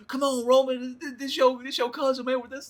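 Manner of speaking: high pleading voice; a light impression of a pleading brother; imitating Jimmy pleading. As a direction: beg your cousin to stop. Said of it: "whenever Jimmy's like".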